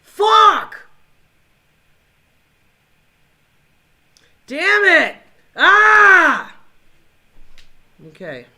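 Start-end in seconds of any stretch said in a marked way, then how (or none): 0.0-6.5 much higher, much louder yelling; furious screams. Real, not acted. Gamer losing again, screaming in real fury. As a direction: scream out in pure rage. Raging at the game.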